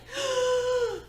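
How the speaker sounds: sharp high gasp for air; a mock panic gasp, high and sudden; panicked gasp. Reenacting her meltdown with a high gasp. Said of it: "I can't breathe".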